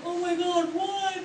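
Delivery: high-pitched alarmed squeak; mock panic, pitched high; retold scared. Girl thinking she's in trouble, playfully scared. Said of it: "I was so scared".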